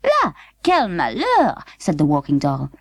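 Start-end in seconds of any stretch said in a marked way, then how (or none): 0.0-1.6 slow, high dramatic French sigh; French dismay. A narrator's reading. Walking doll laments the news, high and slow. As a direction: lament it like a fine lady.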